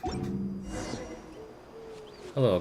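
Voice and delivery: ordinary talk, nothing marked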